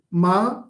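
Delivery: MA sustained high and level. Shown as a demonstration. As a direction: hold "ma" up on one even note. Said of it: "the same, you know, uh, level".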